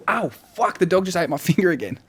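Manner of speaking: high-pitched real ouch, half laughing; startled high complaint breaking into a laugh; pained yelp. Real, not acted. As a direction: yelp as if just nipped. Teller is bitten by the dog.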